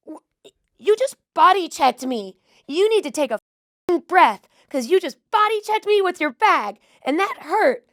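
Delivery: high, loud, slow imitation of an irate stranger; impersonating the woman who yelled at her; mock angry rant. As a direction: ham up the furious woman scolding. Acting out angry airport woman.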